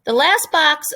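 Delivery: normal talking voice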